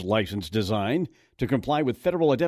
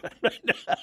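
short chuckle; 8 semitones higher than usual. Real, not acted. Host laughs at his own joke.

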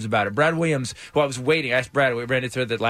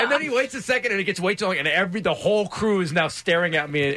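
usual speaking voice

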